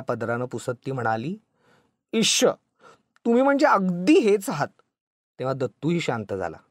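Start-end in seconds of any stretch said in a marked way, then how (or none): 2.1-4.7 high-pitched, affectionate exasperation; playful wifely reproach, pitched up and louder; coy chiding. A narrator's reading. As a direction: chide him fondly and coyly. Wife coyly chides husband, wiping his eyes.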